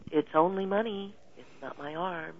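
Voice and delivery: hushed reassuring mantra; quiet self-talk. Retold or imitated. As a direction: talk yourself down gently. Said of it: "say to myself".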